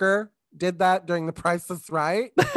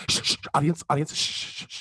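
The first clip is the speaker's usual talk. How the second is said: repeated hissed mock shushing; host shushing; 4 semitones lower, much faster than usual. Game show host to noisy audience, mock shushing. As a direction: imitate a host insistently hushing a crowd. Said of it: "It's a lot of".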